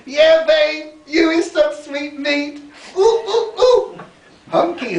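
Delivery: very high, loud, camp squeals and laughing coos; flamboyant yelling in a thick accent. Retold or imitated. Dancer flirting loudly with Gary on the beach, camp high yells in thick accent. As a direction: shriek it out, shamelessly flirting. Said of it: "yelling out in a thick accent".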